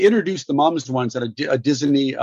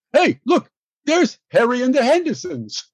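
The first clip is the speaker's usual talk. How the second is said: much higher, calling out; mock inmate call; 11 semitones higher than usual. Inmates shouting at Lithgow across the jail, much higher mock call. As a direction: holler across the yard, teasing. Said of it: "the guys in jail saying".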